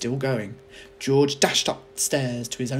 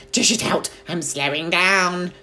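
put-on screechy old grandma; 7 semitones higher, 8 dB louder than usual. A narrator's reading. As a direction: drag out the boast like a cackling witch. Grandma demanding more medicine.

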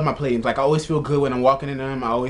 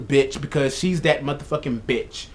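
usual speaking voice

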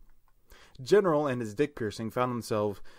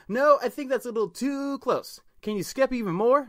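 high, insistent little voice; demanding; 11 semitones higher than usual. Acted. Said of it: "Raphael demanded".

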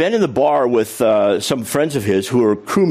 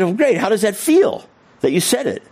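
encouraging delight; 10 semitones higher than usual. Retold or imitated.

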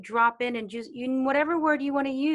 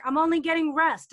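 higher, louder upset protest; student protesting louder, let down; 6 semitones higher, 7 dB louder than usual. Retold or imitated. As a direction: complain that the answer is only rest. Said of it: "and they get upset".

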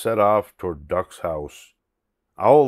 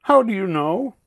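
high, loud worried question; Duck's high anxious voice; whimpering Duck; 12 semitones higher, 5 dB louder than usual. Acted. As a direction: ask high-pitched, a touch fearful. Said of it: "she whimpered".

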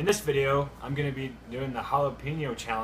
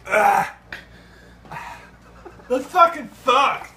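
pained laughing; 11 semitones higher, 11 dB louder, much slower than usual. Real, not acted. Pained laughing after hot peppers.

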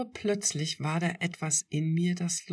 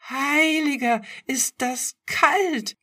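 very high, loud, slow squeal; 12 semitones higher, 10 dB louder, slower than usual. A narrator's reading. Narrator squealing at the cold sea water. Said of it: "quiekte ich".

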